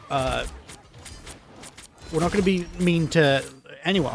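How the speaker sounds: ordinary talk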